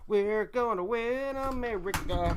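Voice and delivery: slow, drawn-out, high shout; rally cry. Real, not acted. Streamer rallying America at sign-off, slow shout. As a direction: belt it like a campaign rally.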